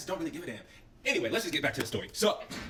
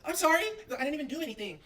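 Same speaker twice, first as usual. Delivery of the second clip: put-on high, prim girl voice; mock prissy apology; 11 semitones higher than usual. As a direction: imitate a timid preppy girl apologizing. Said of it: "the girls we with are like".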